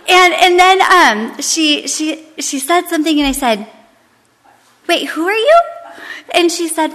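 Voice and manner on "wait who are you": high, rising puzzled question; taken aback. Retold or imitated. Hostess suddenly questioning the lady inside, puzzled and high. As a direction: pitch it up in sudden confusion.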